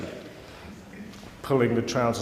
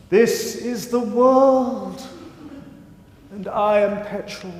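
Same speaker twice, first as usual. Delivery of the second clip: high, drawn-out grandiose proclamation; mock-grand art-school proclamation; pompous declaration; 10 semitones higher, 4 dB louder, slower than usual. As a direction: declaim it like a self-important performance artist, pitched way up. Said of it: "making pompous declarations".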